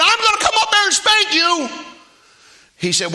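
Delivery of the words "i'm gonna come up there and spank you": very high, loud mom's yell; acted-out mother at wits' end; angry threat. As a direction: threaten at the top of your voice. Mother's shouted threat to boy.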